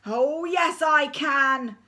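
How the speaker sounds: loud panto retort; deputy head thundering at the school. Acted. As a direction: thunder it back defiantly. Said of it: "thundered the deputy head".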